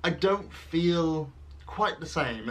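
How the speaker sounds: normal talking voice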